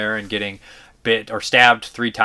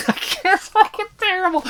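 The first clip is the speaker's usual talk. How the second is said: high, laughing through the words; real laughter; 5 dB louder than usual. Real, not acted. Reviewer breaking up at the terrible accent, laughing. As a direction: crack up while trying to speak. Cracking up at own bad impression.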